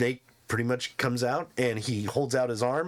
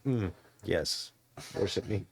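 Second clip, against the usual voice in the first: smug; 7 semitones lower, 5 dB quieter than usual. Acted. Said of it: "Rin's just like".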